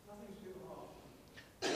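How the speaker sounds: hushed and slow, letting it sink in; almost breathe "Nothing's too hard" at half speed; soft, slow take. Acted. Pastor to congregation, hushed and slow. Pastor restates a truth softly.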